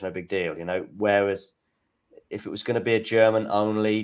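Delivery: ordinary talk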